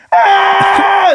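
high, loud scream. Pretend. Superman screaming as he flies in, loud high scream. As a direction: let out a falling scream.

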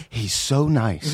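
slow, drawn-out mock admiration; mock gushing. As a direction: imitate an over-impressed admirer. Voicing people's easy praise of actors.

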